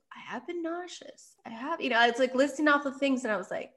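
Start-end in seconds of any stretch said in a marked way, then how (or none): 0.1-1.8 dawning realization. Retold or imitated. Realizing while staring at test.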